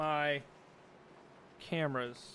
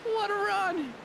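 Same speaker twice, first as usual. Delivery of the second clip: much higher, hammed-up thrilled cry; bigger excited exclaim; 3 dB louder, slower than usual. Real, not acted. Streamer repeats his reaction to the win, bigger excited cry. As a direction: oversell the finish for laughs.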